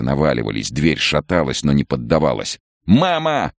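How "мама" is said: put-on very high child's cry; boy yelling at high pitch; wailing yell. A narrator's reading. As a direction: wail out for mother. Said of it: "продолжал вопить Сева".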